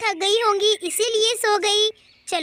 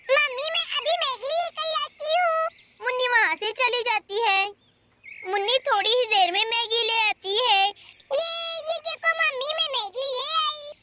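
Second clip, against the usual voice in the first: put-on a small girl coaxing mom for noodles; Munni's little girl voice; 3 semitones higher than usual. Munni fetches the Maggi.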